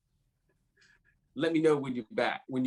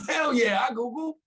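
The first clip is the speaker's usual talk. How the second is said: high excited exclamation; 10 semitones higher than usual. Acted. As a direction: blurt it out proudly. Excited reply to being asked.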